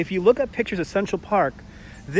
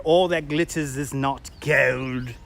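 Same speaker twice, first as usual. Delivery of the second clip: slow, hammed-up British accent; mock-Shakespearean British drawl; horrible British accent; 2 semitones lower, much slower than usual. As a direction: recite it grandly in a bad British accent. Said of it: "read them in a horrible British accent".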